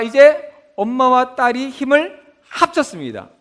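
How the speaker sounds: ordinary talk, nothing marked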